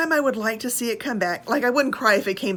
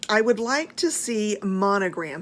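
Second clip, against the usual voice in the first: ordinary delivery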